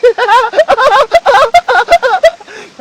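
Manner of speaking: long giddy laugh, very high; real giddy laugh over the catch; excited high laugh. Real, not acted. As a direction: laugh out the thrill. Laughing after landing big fish.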